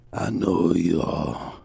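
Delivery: put-on slow, low elderly voice; old man. Old man in wheelchair recognizing the champ, slow low voice. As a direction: speak up slowly as a very old man. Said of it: "this old man".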